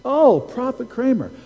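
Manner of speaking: high-pitched, delighted mock greeting; eager caller. Caller reaches the prophet on the phone, acted eagerness.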